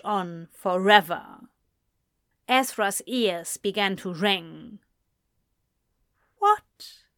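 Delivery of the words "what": ordinary delivery, nothing marked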